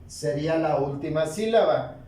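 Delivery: usual speaking voice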